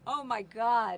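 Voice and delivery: usual speaking voice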